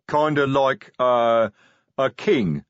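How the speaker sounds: words dragged out of him; hesitant, grudging troll voice; a troll forced to answer; reluctant troll. Acted. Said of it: "as if every word was being dragged".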